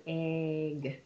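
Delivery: "Egg" with a long held vowel; slow sounding-out of "Egg"; said slowly. Shown as a demonstration. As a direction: stretch "Egg" so each sound is heard. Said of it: "Say it slowly".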